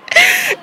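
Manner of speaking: sudden high laugh; high burst of laughter. Real, not acted. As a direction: laugh at the craziness. Laughs at own story.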